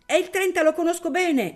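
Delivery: put-on high, loud child's shout. Little boy shouting the number to his father, high and loud. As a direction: cry it out like a small boy. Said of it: "gridò il bimbo".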